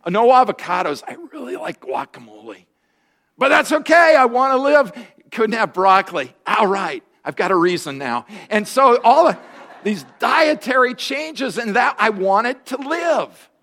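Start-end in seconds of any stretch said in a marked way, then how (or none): 3.4-4.9 a loud, cheerful resolve; high emphatic refrain. Real, not acted. Pastor giving up foods to stay alive, high and loud. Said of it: "repeated refrain". 8.5-13.5 slow emphatic build. Real, not acted. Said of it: "sermon emphasis".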